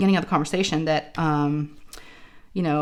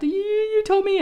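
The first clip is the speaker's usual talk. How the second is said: imitated tearful whine. Daughter to mom after the throat swab, mock tearful. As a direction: wail it like a betrayed kid. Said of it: "she was, like, in tears".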